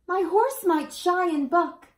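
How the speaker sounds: put-on high, loud child's fear; frightened child. A narrator's reading. Child tells citizens why they won't cross, high and scared. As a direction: voice a scared little rider.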